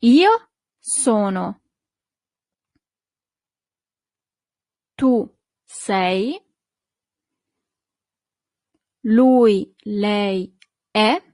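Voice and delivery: slow, clear forms with long pauses for the class to echo; model for repetition. Shown as a demonstration. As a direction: say each form slowly so students can repeat. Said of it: "please repeat right after me".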